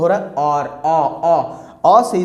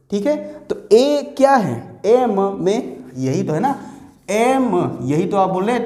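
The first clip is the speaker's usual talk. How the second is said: ordinary talk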